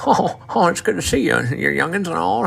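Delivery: put-on high, far-off old country uncle voice with a laugh; Uncle Roscoe impression. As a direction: pitch it way up like a chatty old southern uncle. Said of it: "he always talked like this".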